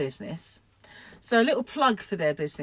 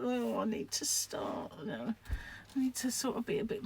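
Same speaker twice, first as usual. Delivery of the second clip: unvoiced whisper, meek; meek whispered reply to her own scolding; 3 semitones higher, 10 dB quieter than usual. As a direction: whisper it like a sheepish promise. Quoting herself, whispered reply.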